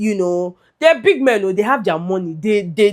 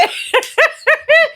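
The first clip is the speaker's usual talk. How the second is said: high-pitched giggling burst; real laughter. Real, not acted. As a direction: crack up at your own hype. Cracks up after praise names.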